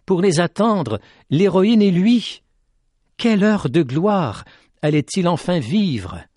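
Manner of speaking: usual speaking voice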